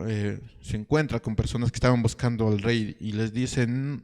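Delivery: normal talking voice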